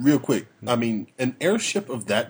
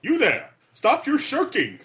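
high-pitched, hammed-up call of command; captain scolding crew; 11 semitones higher than usual. Captain orders Gavin to stop shirking, hammed-up scolding. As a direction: call out sharply to a slacking crewman.

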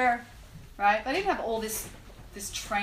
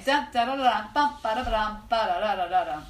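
ordinary talk

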